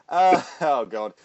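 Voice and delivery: ordinary talk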